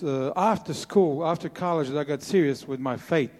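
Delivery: normal talking voice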